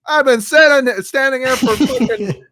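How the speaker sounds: loud high furious rant, slowing down; yelling at the girl who pushed her; angry yell. Retold or imitated. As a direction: lay into her at full volume. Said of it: "I'm like".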